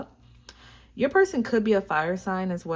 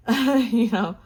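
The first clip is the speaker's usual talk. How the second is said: laughed out, higher and louder; laughing; 4 semitones higher, 8 dB louder than usual. Real, not acted. Reader joking that death card isn't real death, laughing. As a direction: giggle through the words. Laughing at her own joke.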